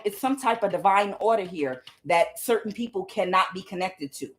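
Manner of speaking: usual speaking voice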